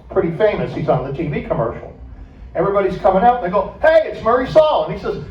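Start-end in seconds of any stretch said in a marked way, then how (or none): 3.8-4.8 calling out. Retold or imitated. Crowd spots Murray from street.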